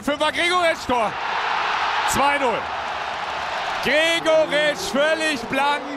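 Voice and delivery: rising shout into the goal; goal call. Acted. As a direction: call the goal, then stretch it.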